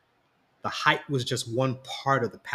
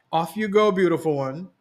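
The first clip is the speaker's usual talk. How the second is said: higher, louder fond send-off; playful twinkle; 6 semitones higher, 5 dB louder than usual. A narrator's reading. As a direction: shoo her away affectionately. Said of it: "Dad said with a twinkle in his eye".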